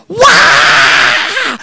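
very high, loud mock scream; high screaming wail. Prank recording blasting across the sleeping camp: loud high wail. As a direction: let out a long echoing wail.

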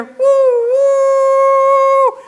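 high, loud ecstatic "woo"; joyful whoop. Real, not acted. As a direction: let out a joyful preacher's holler. Preacher whoops mid-sermon.

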